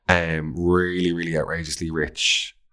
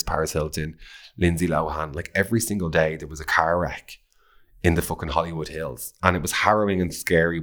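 usual speaking voice

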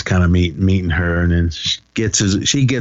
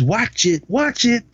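high, squeaky imitation of a scolding mother; a hammed-up warning, high and thin; mock threatening mom; much slower than usual. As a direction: play the switch-waving mom, pitched way up. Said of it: "she was like".

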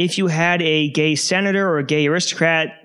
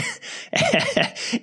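breathless high-pitched giggle; a scandalised, airy titter; 4 dB quieter than usual. Retold or imitated. Crowd reacting to the insult.

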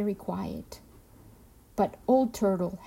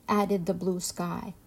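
usual speaking voice

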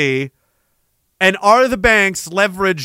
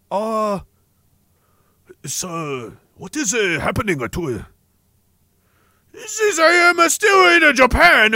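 fake Japanese accent; 3 semitones higher than usual. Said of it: "Do more racist fake accents".

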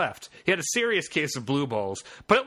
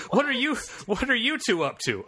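Oscar voice; 8 semitones higher than usual. Acted. Oscar voiced in read fanfic.

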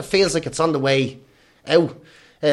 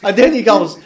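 ordinary delivery

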